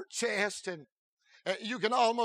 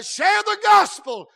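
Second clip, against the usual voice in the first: mock quote; 12 semitones higher, 13 dB louder than usual. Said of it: "they'll say".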